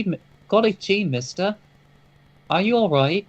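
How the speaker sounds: high, bright little street urchin; squeaky gee-whiz kid voice; wholesome 1950s kid. Pretend. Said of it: "giving a line ... ragamuffins in the street".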